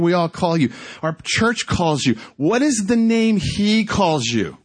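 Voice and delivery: high, driving, insistent exhortation; impassioned preaching. Retold or imitated. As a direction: press each point home urgently. Impassioned urging about God's name.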